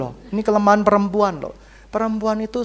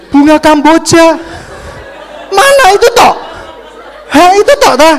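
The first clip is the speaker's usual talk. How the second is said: much higher, louder laughing squeal; mock demanding girl; 8 semitones higher, 12 dB louder than usual. Girls wanting romantic melodrama mocked, laughing squeal.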